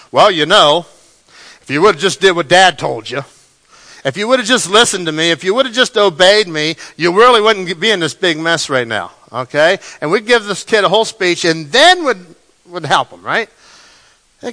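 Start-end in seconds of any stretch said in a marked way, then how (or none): 0.1-9.1 loud scolding dad lecture; lecturing parent. Retold or imitated. Dad lecturing his kids after they did something crazy, loud scolding. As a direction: scold the kid like a fed-up father. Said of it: "What do we do as parents".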